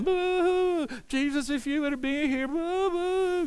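hammed-up fake sobbing, falsetto; mock crying mourners. Mocking the weeping mourners at Lazarus' tomb, falsetto wail. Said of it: "they're crying and stuff".

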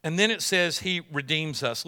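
ordinary talk

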